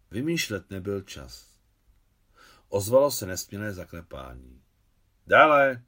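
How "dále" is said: loud call. A narrator's reading. Natália calls the knocking visitor in, loud and high. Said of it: "křikla nahlas Natália".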